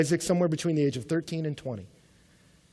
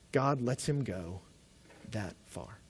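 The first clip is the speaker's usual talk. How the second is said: slow and much softer; solemn hush; 7 semitones lower, 11 dB quieter, much slower than usual. Real, not acted. Preacher stressing how far God let it go, hushed and slow. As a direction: drop to a near hush.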